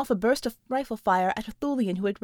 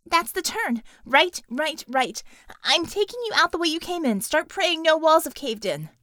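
higher, louder urgent warning; urgent character voice; 5 semitones higher, 4 dB louder than usual. Acted. Warning the team over comm, higher and urgent. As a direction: call out the danger fast and high.

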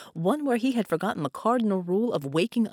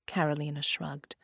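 usual speaking voice

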